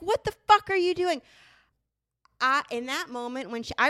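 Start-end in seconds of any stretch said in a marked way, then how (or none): 0.0-1.2 higher, louder shocked yell; hammed-up husband yelling in alarm; mock panicked yell. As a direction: yell it like a man watching his toys smashed. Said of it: "He's like".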